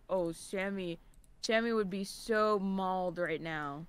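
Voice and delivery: low, soft mocking imitation. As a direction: dumb it down, low. Mocking what S1 said.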